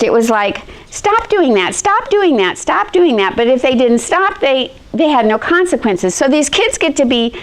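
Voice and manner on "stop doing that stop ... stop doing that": higher, repeated nagging; nagging parent. Retold or imitated. Parents nagging a kid with no consequences, mock nagging. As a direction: nag like an ignored parent.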